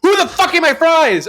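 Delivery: high, shrill mock outrage; angry sister. Sister freaking out over stolen fries, high and angry. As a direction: shriek it like a furious kid sister. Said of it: "literally be like".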